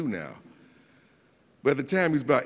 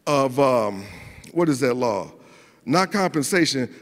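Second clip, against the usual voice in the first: ordinary talk, nothing marked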